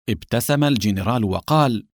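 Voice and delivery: plain reading voice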